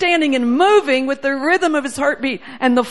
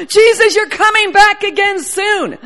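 loud cry to Jesus with a laugh; 5 semitones higher, 6 dB louder than usual. Retold or imitated. Congregation crying out to Jesus, loud and joyful. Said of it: "crying out".

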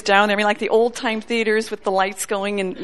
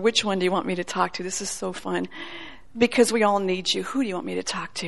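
hushed prayer; 2 semitones lower, 7 dB quieter than usual. Retold or imitated. Her retold prayer to the Lord.

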